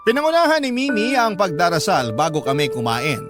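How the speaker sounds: ordinary talk, nothing marked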